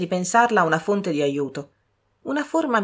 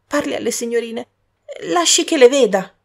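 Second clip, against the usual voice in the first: begging through tears, rising louder; crying beggar; tearful plea; 6 semitones higher, 5 dB louder than usual. Acted. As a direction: plead with a sob in the voice. Beggar begs, crying heard.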